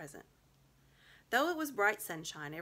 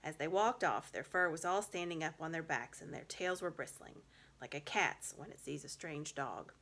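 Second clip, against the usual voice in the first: usual speaking voice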